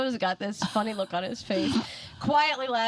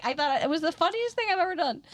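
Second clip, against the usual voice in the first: ordinary delivery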